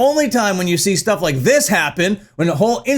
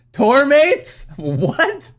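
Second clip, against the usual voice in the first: usual speaking voice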